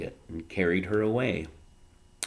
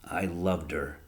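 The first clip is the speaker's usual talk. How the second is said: quiet grief; 2 semitones lower, 5 dB quieter, slower than usual. A narrator's reading. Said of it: "said the father".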